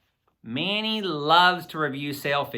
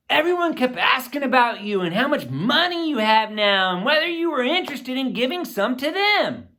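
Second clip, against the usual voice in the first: put-on higher, eager boyish friend voice; Manny's voice; 7 semitones higher than usual. A narrator's reading. Manny telling his partner about school, eager higher boy voice. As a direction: play the excited business partner, pitched up.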